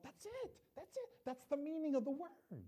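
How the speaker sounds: high, bright-eyed eureka cry; suddenly seeing the answer, thrilled; excited realization. Retold or imitated.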